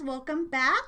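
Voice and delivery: ordinary talk, nothing marked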